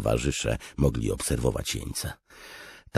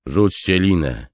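raised, loud command; giving an order; 7 semitones higher, 8 dB louder than usual. A narrator's reading. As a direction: order the men sharply. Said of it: "polecił Dalinar".

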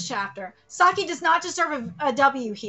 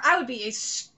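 normal talking voice

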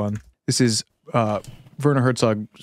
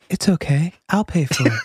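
[name] impression; 5 semitones higher than usual. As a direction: echo it back in a gentle MJ whisper. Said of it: "My, my, my, my M J's getting there".

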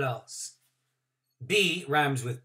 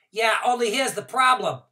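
put-on high, louder kid explaining quickly; worried child voice; 9 semitones higher, 8 dB louder than usual. A narrator's reading. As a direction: plead with the teacher nervously. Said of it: "I said".

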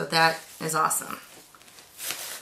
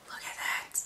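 awed whisper; 12 dB quieter than usual. Real, not acted. Admiring the snow globe.

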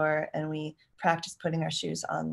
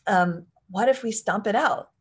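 upbeat suggestion; 2 semitones higher, 6 dB louder than usual. Shown as a demonstration. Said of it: "you might say something like".

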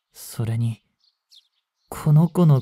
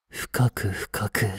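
lower, softer and slowed, drawn out; low slow menace; 7 semitones lower, 8 dB quieter, slower than usual. Acted. Younger vampire threatens the girl with a deep bite, low and slow. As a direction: let the threat sink in.

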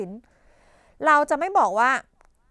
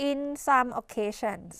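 very slow, softer demo phrase; slow model phrase; 4 dB quieter, much slower than usual. Shown as a demonstration. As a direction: say it slowly to show the chunk. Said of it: "เราจะบอก". (พูดช้าๆ เบาลง)

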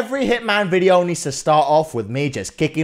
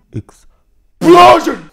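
loud, slow, drawn-out payoff word; dramatic boom; 11 semitones higher, 10 dB louder, much slower than usual. Acted. Gamer hyping the coming explosion. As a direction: land it like a punchline.

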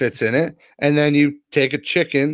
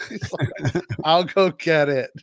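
laughing; 2 semitones higher, 3 dB quieter than usual. Real, not acted. Laughing while retelling coworker's reply.

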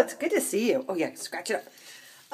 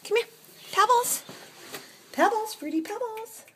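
much higher, sweet call of the cat's name; pet-talk cooing; 13 semitones higher, 3 dB quieter than usual. Acted. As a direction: coo the name up high. High pet talk calling the cat.

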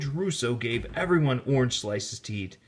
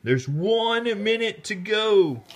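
excited call; 8 semitones higher, slower than usual. A narrator's reading. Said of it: "yelled Jake".

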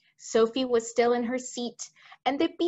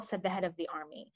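usual speaking voice

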